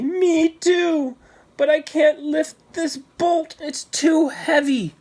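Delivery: put-on high, straining boy's voice, slowing at the end; scared little boy. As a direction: push at the heavy bolt, frightened. Tommy scared, struggling with bolt.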